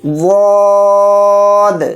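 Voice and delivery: واد read thick and heavy, drawn slowly; heavy full-mouth letter. Shown as a demonstration. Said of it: "اس کو موٹا یعنی پر پڑھنا ہوتا ہے". (واد کو پر پڑھیں)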